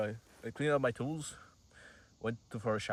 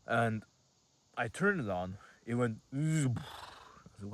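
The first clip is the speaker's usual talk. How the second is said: normal talking voice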